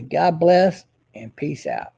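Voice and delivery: drawn-out, measured farewell; slow, deliberate sign-off. Shown as a demonstration. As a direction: stretch the sign-off slowly. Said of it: "I'll end it like this".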